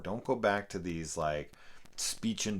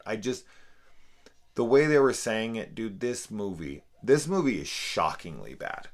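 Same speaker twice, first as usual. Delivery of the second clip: ordinary talk, nothing marked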